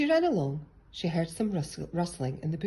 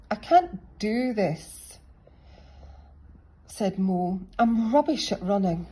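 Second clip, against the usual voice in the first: despairing; 5 semitones higher, 5 dB louder than usual. A narrator's reading. Mole gives up.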